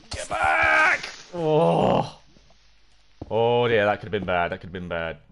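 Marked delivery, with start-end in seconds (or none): 0.1-2.2 high, loud yell to back off; panicked shout. Real, not acted. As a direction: shout an attacker away in panic. Fending off attacker in game.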